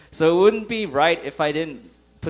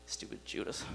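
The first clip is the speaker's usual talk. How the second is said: muttered aside; 9 semitones lower, 19 dB quieter than usual. Real, not acted.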